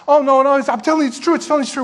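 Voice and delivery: high, urgent protest; excited insistence. Acted. Rhoda pleads with the doubters inside, high excited insistence. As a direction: insist breathlessly that they must believe you.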